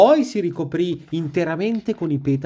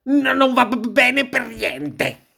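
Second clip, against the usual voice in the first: shivering, stuttering on the consonants, higher voice; teeth chattering; 5 semitones higher than usual. A narrator's reading. Wolf out of an icy bath, teeth-chattering exclamation. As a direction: stammer it out as if freezing, stretching the end. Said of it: "Battendo i denti".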